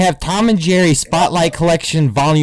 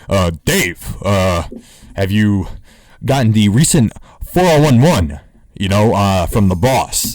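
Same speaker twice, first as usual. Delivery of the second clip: Dave Chappelle; 5 semitones lower, 4 dB louder than usual. Retold or imitated.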